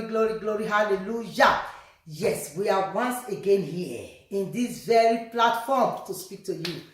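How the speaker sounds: normal talking voice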